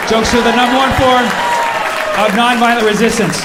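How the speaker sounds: loud, punchy declaration; emphatic proclamation. Real, not acted. Comedian repeating his claim about jokes, loud proclamation. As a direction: hammer it home to the crowd. Third take, quoting himself loudly.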